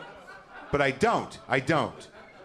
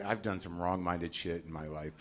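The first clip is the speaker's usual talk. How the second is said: ordinary talk, nothing marked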